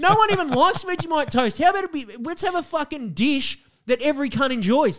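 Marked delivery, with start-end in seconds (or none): 0.0-3.1 much higher, louder heated insistence; indignant outburst. Real, not acted. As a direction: insist on it, getting worked up. Heated argument over national dish.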